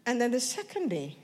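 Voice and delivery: ordinary talk, nothing marked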